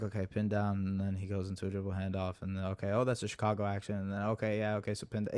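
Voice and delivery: low, soft, quick self-talk; mumbling to himself. Retold or imitated. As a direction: mutter a running play-by-play under your breath. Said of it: "mumbling that type of stuff to myself".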